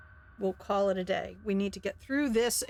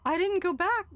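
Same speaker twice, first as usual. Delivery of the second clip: tearful joyful woman; 8 semitones higher than usual. Acted. Crystal overjoyed at youth.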